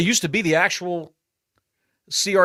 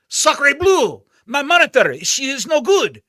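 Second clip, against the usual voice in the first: high, loud mock French outrage; French accent; 9 semitones higher, 5 dB louder than usual. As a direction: cry it out like a hammy French chef. Mock French outburst over broken monitor.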